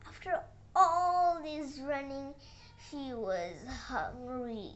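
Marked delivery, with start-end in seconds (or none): none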